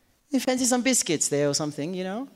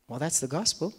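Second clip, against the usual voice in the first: ordinary talk, nothing marked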